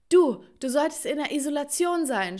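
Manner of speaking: high, loud outraged yell; accusing scream. A narrator's reading. Mother catching daughter out of isolation, accusing scream. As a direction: stammer the first word, then shout the accusation. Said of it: "schrie sie".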